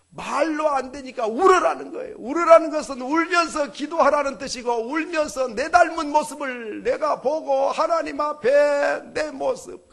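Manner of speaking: fervent preacher shouting his exhortation; full-force preaching. Acted. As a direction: pound it home from the pulpit.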